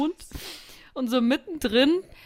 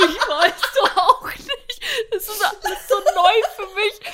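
laughing to tears; 10 semitones higher, 4 dB louder than usual. Retold or imitated. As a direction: barely get it out through laughter. Her own answer, laughing, near tears.

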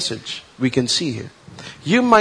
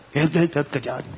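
softer, flat dismissive echo of help; mocking reply; 2 semitones lower, 5 dB quieter, faster than usual. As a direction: brush off their cry with sarcasm. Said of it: "I saw them".

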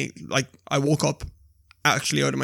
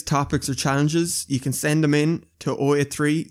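ordinary talk, nothing marked

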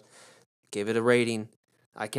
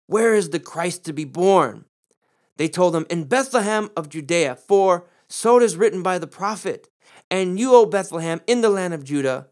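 raised, ringing scripture reading; scripture read with force; 4 semitones higher, 4 dB louder than usual. Acted. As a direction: proclaim the prophecy to the congregation. Reads scripture with preaching force.

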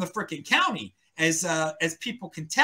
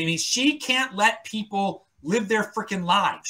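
ordinary delivery

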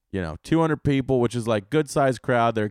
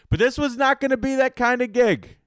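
emphatic; 14 semitones higher, 4 dB louder than usual. Acted. Emphatic repeated punchline line.

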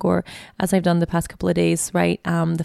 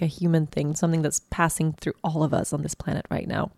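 ordinary talk

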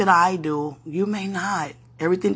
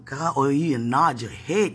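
ordinary talk

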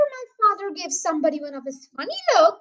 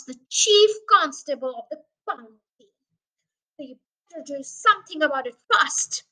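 loud, punched-out angry demands; angry threatening tirade; 7 dB louder than usual. Acted. Mister Hazel threatens the sergeant's stripes, loud and bullying. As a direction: jab each phrase at him.

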